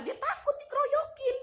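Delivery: high-pitched, loud jeer; scornful outburst. Real, not acted. Venting about a relative afraid of being ganged up on, scornful outburst. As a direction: blurt it out high and loud, mocking. Mocking the person's fear of mobbing.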